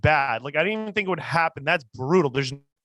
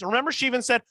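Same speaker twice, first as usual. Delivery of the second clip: usual speaking voice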